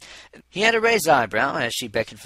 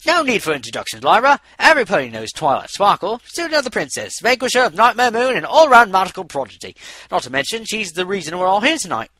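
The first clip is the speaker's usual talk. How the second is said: loud, high eager welcome; enthusiastic greeting; 8 semitones higher, 12 dB louder than usual. Acted. The doctor greeting famous Twilight, loud and eager. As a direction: gush over her like a fan. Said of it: "vigorous hoof shake".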